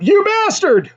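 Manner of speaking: high, outraged yell at a friend; angry shout. A narrator's reading. Two friends catching their buddy behind a bush, outraged yell. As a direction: shout your disgust at him. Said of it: "they angrily shout".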